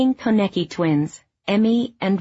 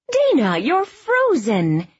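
higher, slow, motherly concern; mother fussing over a frozen guest; slow, higher voiced mom; 8 semitones higher, 4 dB louder, slower than usual. A narrator's reading. Mother greets cold friend.

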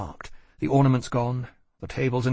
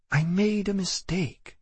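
higher, slow and tired; weary; 8 semitones higher, slower than usual. A narrator's reading. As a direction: say it worn out, giving up. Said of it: "she said wearily".

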